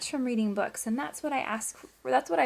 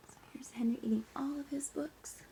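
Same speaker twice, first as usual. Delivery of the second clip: soft whisper to the class; whispered aside; 3 semitones higher, 9 dB quieter than usual. Real, not acted. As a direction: lean in and whisper it.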